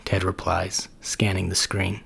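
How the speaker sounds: usual speaking voice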